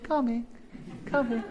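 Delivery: high reply that fades to soft; distant reply. Retold or imitated. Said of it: "the voice came back".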